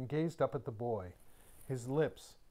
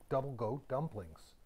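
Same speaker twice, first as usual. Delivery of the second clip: ordinary delivery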